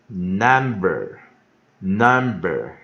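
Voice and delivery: "number" said slowly and firmly, stress on NUM; slow, careful teacher's "number"; pronunciation demo. Shown as a demonstration. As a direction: model "number" for learners, each take separate. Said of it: "下一个单字number".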